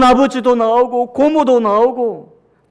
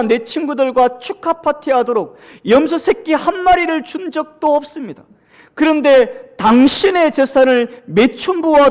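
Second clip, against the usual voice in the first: elder son's complaint; 5 semitones higher than usual. Acted. Elder son confronts his father before guests, indignant. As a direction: pour out the grievance at the father. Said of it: "큰아들 대사".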